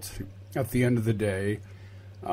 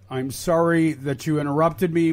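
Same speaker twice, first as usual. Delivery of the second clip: normal talking voice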